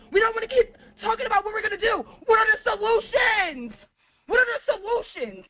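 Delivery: super mad. Real, not acted. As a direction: demand answers, truly fed up. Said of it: "I'm super mad".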